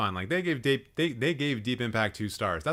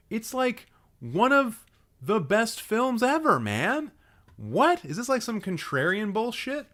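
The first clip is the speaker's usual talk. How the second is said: high-pitched exasperated disbelief; incredulous protest; 10 semitones higher than usual. Real, not acted. Streamer defending a favourite film to friend, high incredulous protest. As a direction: plead the case, pitch soaring.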